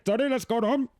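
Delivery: put-on Indian accent. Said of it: "So they do talk like that".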